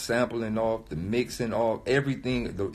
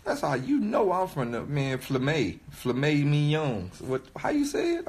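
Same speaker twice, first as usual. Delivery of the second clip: ordinary delivery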